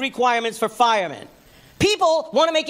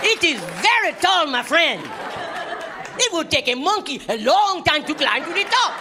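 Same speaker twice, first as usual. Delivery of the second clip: mock African tour guide accent; hammed-up African street guide voice. Comic imitating an African tour guide hustling him in an African accent. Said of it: "so many Africans coming at me".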